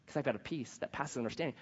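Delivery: soft, low, untroubled answer; calm reply. Acted. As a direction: drop to a hushed, peaceful reassurance. Calm answer to the agitated relatives.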